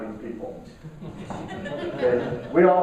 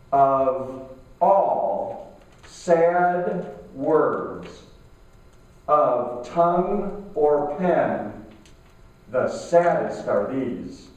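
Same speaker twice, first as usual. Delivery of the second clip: drawn-out quote with long pauses, falling lower toward the end; very slow; 3 semitones lower, much slower than usual. Shown as a demonstration. Speaker slowly reciting a poet's quote to the audience, very slow. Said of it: "I'm gonna say this very slowly".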